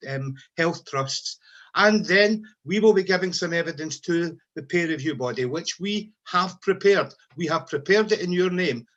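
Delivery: usual speaking voice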